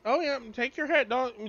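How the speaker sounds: ordinary talk, nothing marked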